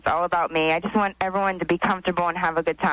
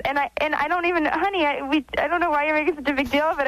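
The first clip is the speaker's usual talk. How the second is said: higher-pitched, defensive protest; real exasperation; 6 semitones higher than usual. Real, not acted. Wife telling husband it's no big deal, exasperated. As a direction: push back at the fuss, voice raised in pitch.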